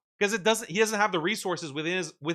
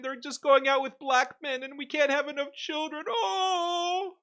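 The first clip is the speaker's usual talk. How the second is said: much higher, slow, whiny sob-voice; mock crying whine; 9 semitones higher, slower than usual. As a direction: wail it like a crybaby, hammed-up. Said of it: "who they cry".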